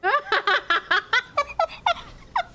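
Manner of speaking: giggling laugh, high and loud. Real, not acted. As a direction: let a high giggle burst out. Laughs at own fake crying.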